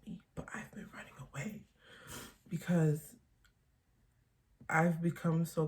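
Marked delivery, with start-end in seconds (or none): 0.1-1.7 drops to a bare whisper mid-sentence. Real, not acted. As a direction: let the admission fall into a whisper. Confessing something painful to camera.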